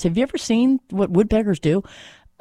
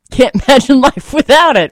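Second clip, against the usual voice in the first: louder, higher, laughing through the words; 7 semitones higher, 7 dB louder than usual. Real, not acted. Host musing on humans to listeners, laughing.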